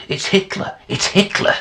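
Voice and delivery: very high, loud repeated shout; frantic yelling. Acted. Man alerting onlookers who ignore him, frantic high yelling. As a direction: yell it out to make everyone listen. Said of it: "I'm yelling".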